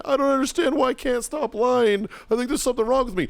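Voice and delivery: high-pitched whiny blubbering; hammed-up tearful whine; mock crying. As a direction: imitate a kid wailing in self-pity. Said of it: "He starts crying".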